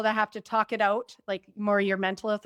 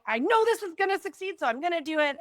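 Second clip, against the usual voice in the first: much higher, bold certainty; confident ego; 8 semitones higher, faster than usual. Retold or imitated. Ego-authority person announcing their plan, cocky confidence. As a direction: sell the win like it's guaranteed. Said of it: "ego authority who is just like".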